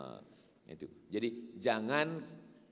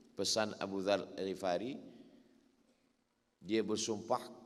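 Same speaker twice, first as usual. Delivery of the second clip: ordinary talk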